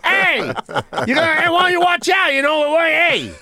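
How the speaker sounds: high-pitched New York street heckling; construction worker yelling. Acted. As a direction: holler at passers-by like a worker on break. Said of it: "you're just yelling at people".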